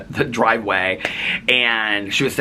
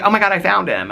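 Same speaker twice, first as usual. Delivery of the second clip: excited relief; 10 semitones higher, 3 dB louder than usual. Retold or imitated. Retells friend's second call, dog found.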